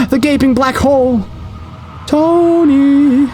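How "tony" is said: eerie call. Acted. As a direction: summon him from the darkness. The darkness calls again.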